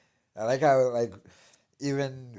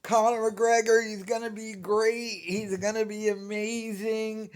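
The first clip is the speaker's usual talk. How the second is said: mocking hype-man impression; 9 semitones higher, 3 dB louder than usual. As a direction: parody an overexcited pundit, pitched way up. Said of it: "He was the guy who was like".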